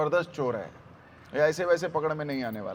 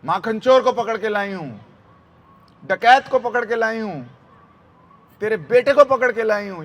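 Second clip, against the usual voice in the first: loud, higher emphatic accusations; आरोप लगाती गोपी; 4 semitones higher, 8 dB louder than usual. Retold or imitated. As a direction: pile up each charge more forcefully, in mock outrage. गोपी यशोदा से शिकायत करती है. (ज़ोर देकर आरोप गिनाती गोपी)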